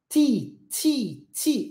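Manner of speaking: tongue behind the teeth, crisp hissy "T"; three separate demonstrated "T" syllables; English T sound. Shown as a demonstration. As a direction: say "T" as "tsee", hissed release. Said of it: "aqui é a mesma coisa".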